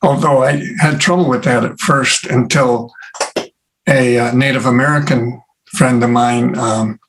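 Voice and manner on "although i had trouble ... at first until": ordinary talk, nothing marked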